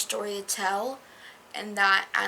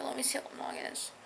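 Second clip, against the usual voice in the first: muttered aside; 3 semitones higher, 8 dB quieter, faster than usual. Real, not acted. Checking chapter length quietly.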